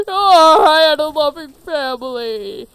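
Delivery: mock sobbing. Character mourns loss.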